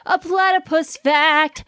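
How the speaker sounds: high, slow, drawn-out echo; high sing-song echo. Acted. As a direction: stretch it out up high. Echoing the intro, high and drawn out.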